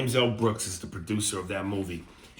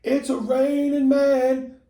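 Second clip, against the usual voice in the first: high, slow sing-song camp voice; mock camp crooning; 14 semitones higher, 8 dB louder, slower than usual. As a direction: ham up an over-the-top flamboyant guy. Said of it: "over-the-top, stereotypical, flamboyant".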